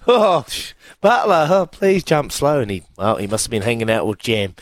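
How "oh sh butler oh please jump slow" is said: retold dismay. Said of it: "and I was like".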